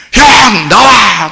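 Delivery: mock angry shout. Younger brother storming home calls his brother out, mock angry. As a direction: holler it as if storming in. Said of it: "하면서 막 승질내면서 들어와요".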